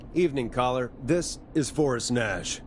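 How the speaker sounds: ordinary talk, nothing marked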